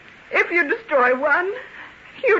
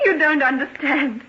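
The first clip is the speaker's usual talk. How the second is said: normal talking voice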